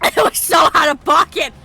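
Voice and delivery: loud laughing outburst. Real, not acted. Streamer yelling at the game, laughing. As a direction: shout it through laughter.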